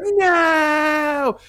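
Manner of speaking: high, loud hammed-up "No!"; mock movie shout. Mocking the couple's cry before the crash with a high loud shout. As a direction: belt it out like bad acting. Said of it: "They're like".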